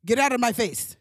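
mock angry yell. Dad yelling at his son, loud mock anger. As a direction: bark it like a fed-up dad.

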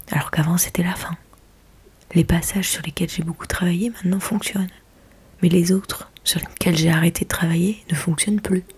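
hushed low whisper. Real, not acted. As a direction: whisper beside a sleeping husband. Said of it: "Je parle pas très fort parce que".

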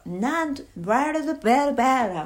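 nonsense syllables "バラバラ" punched loud and high; rhythmic accents. Shown as a demonstration. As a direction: beat out "バラバラバラバラ" with strong bouncing accents. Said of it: "まずリズミカル。 アクセントっていうかなんか".

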